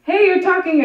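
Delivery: high, loud delighted cry; thrilled. Acted. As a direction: burst out with joy. Thrilled caller finally makes sound.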